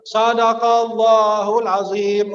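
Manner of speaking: Sadaqallahul azim dilantunkan lambat dan panjang; dilafalkan pelan. Shown as a demonstration. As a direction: lafalkan Sadaqallahul azim perlahan seperti penutup bacaan. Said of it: "Akhirnya dia bilang apa?". (jamaah menutup bacaan, lafal pelan dan panjang)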